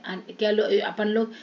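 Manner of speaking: normal talking voice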